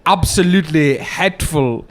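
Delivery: slow, lightly put-on South African accent; lightly lampoon Trevor Noah's South African accent; mock Trevor Noah. Comic mimicking a host's lecture, slow mild South African accent. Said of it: "my least favorite is Trevor Noah".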